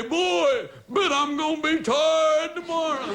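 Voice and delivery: high-pitched comic wail; mock lament. Person lying down late checking the watch, mock lament. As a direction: pitch it way up, hammed-up dread. Acting out bedtime self-talk for laughs.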